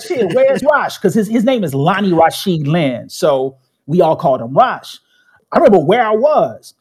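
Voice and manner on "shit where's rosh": mock alarmed question, higher and a bit louder; surprised. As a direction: catch on suddenly and blurt it out. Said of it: "I was like".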